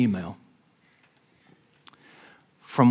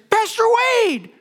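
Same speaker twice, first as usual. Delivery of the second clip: retold shout; 4 dB louder than usual. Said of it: "I heard someone from within that group shout".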